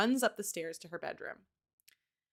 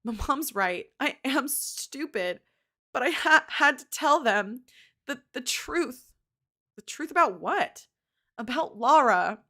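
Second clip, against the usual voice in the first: 1 crying confession; 5 semitones higher, 8 dB louder, slower than usual. A narrator's reading. Sobbing sister confesses.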